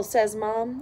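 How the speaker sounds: ordinary delivery, nothing marked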